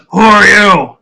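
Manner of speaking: booming ogre challenge; gruff guard demanding answers; lightly voiced ogre guard. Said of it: "he goes".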